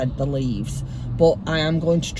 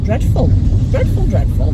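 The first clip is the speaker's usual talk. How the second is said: exasperated; 6 semitones higher, 9 dB louder than usual. Real, not acted. Complaining about roadworks.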